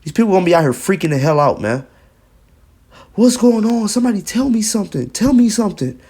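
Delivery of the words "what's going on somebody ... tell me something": panicked plea. Retold or imitated. Mocking panicked people asking.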